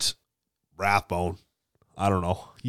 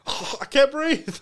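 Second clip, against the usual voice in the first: mock gasping victim. Acting out an allergic reaction.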